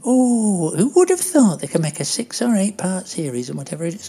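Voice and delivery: high-voiced Doris gushing over a film; rambling, delighted Doris. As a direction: gush fondly in a high, chatty character voice.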